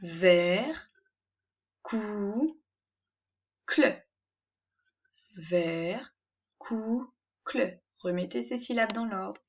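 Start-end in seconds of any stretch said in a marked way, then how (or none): 0.0-4.1 "Ver, cou, cle" said as separate beats with pauses; "Ver, cou, cle" cut into syllables. Shown as a demonstration. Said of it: "Commencez par lire les syllabes". 5.3-7.8 "Ver, cou, cle" said as separate beats with pauses; "Ver, cou, cle" cut into syllables. Shown as a demonstration. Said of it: "Commencez par lire les syllabes".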